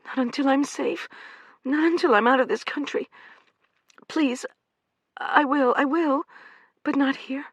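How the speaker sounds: high pleading woman's voice; frightened young woman. Acted. Amanda pleading with the men, frightened and high. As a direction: beg like a trapped animal.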